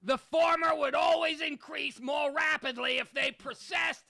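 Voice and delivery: shouting Hitler impression; Hitler rant. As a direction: rant like a dictator at a rally. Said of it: "I can't do him any longer".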